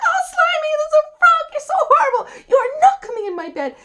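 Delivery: very high, very loud princess outburst; full-force horrified princess; revolted shriek. Acted. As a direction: screech in acted disgust. Princess horrified by frog in bed.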